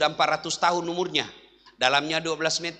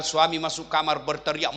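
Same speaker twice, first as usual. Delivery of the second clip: ordinary talk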